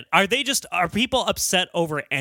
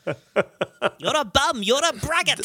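slow, high slasher-film leprechaun; high, drawn-out leprechaun insults; 7 semitones higher, slower than usual. Acted. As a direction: taunt like a horror-movie leprechaun. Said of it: "imagine it's the Leprechaun".

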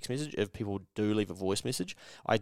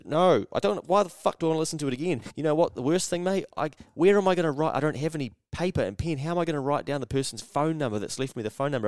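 higher, louder exasperated rant; frustrated rant; 6 semitones higher, 4 dB louder than usual. Real, not acted. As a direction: vent like the machine is driving you mad. Ranting about voicemails.

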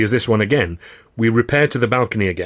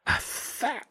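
gasped; 9 dB quieter than usual. A narrator's reading. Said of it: "Freddie gasped".

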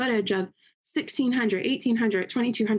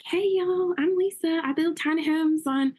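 imitating a Southern accent, pitched higher; Lisa's Southern accent; 6 semitones higher than usual. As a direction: play Lisa greeting her TikTok followers in a sweet Southern accent, voice up high. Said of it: "She's got the greatest Southern accent".

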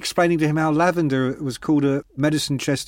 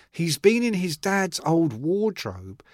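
ordinary delivery

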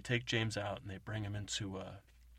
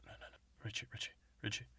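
soft hushed aside; shushing whisper; 7 dB quieter, much faster than usual. Real, not acted. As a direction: hush your friend quietly.

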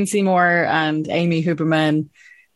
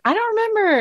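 much higher, surprised quote; puzzled. Retold or imitated. Guest recalls not remembering the host's scene, high surprised. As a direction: sound caught off guard. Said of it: "and I was like".

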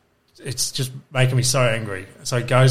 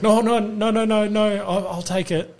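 imitating a desperate buyer, high-pitched; high, hurried backpedalling in mimicry; mock panicked pleading; 11 semitones higher than usual. Retold buyer backing down in panic.

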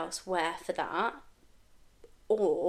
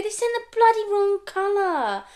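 outraged complaint; 12 semitones higher, 6 dB louder than usual. Retold or imitated. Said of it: "And then I ring Gab and go".

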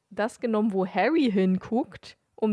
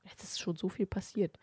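hushed whisper of amazement; 9 dB quieter than usual. Acted. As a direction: breathe it like a private thought. Harry's thought after two months.

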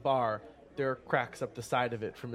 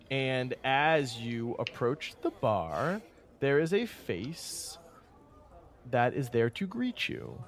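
slow, measured scene-setting narration; game master narration; slower than usual. A narrator's reading. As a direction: set the scene slowly for the players.

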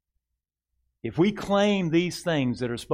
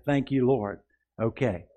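ordinary talk, nothing marked

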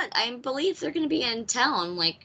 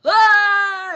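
put-on high, loud drunken whoop; rowdy mates' yell; 11 semitones higher, 12 dB louder than usual. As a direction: burst in hollering like mates barging into the bar. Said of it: "started yelling at him".

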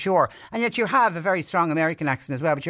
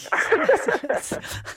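laughing; 13 semitones higher than usual. Real, not acted. Host laughs at his accent.

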